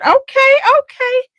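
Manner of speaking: high, eager okay okay; bubbling over with a find; excited. Retold or imitated. Finding an indie brand, high excited okay.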